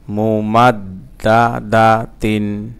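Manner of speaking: "মুমাদ্দাদাতিন" slow and connected, doubled d held; joined reading pronunciation. Shown as a demonstration. Teacher reading the word whole for learners, slowly joined. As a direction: link the syllables of "মুমাদ্দাদাতিন" in measured flow. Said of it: "reading উচ্চারণ".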